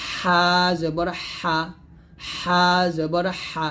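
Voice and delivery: slow, drawn-out হা with thin squeezed air; pressed breathy Ha. Shown as a demonstration. Teacher drilling Ha with zabar, slow pressed breathy হা. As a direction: press mid-throat and let air rush through each long হা. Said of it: "চিকন বাতাস বের করে".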